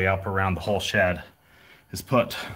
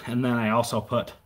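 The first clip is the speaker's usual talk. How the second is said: ordinary talk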